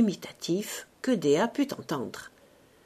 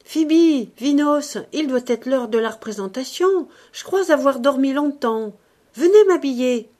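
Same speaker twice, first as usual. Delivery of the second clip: higher, louder call to the servants; 5 semitones higher, 7 dB louder than usual. A narrator's reading. Dea waking calls her maids, calling out. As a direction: call for them from your bed. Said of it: "Dea appela".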